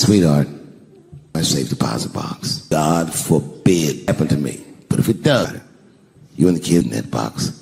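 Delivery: very low, measured voice; deep, serious mock-heroic tone; acted solemn husband. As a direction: drop deep and deliver grave instructions. Husband solemnly instructing his wife in story.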